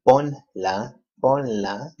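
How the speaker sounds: ponla slow and loud, in two clear syllables; careful syllable demo. Shown as a demonstration. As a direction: sound out ponla firmly, PON then la. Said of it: "There's no accent on this one".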